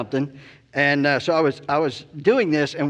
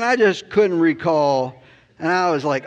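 usual speaking voice

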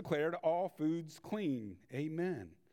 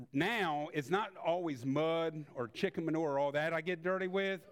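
ordinary talk, nothing marked

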